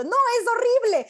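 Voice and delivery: loud, high-pitched outburst of disagreement; mock squabble, protesting hard; arguing. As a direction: burst in to contradict, voice jumping up. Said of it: "discutían como".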